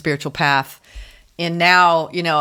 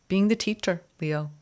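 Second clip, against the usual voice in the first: ordinary delivery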